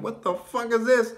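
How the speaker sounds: high, incredulous outburst; stunned disbelief. Retold or imitated. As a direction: pitch it way up in shock. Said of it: "We're like".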